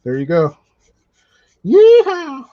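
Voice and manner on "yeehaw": high, loud mock cowboy yeehaw; cowboy whoop. Joking cowboy call about Wyoming.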